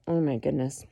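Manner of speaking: ordinary delivery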